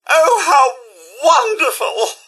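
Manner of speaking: high, loud theatrical gush; gushing delight. Acted. Robot butler to sir, gushing delight. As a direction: exclaim it with soaring delight.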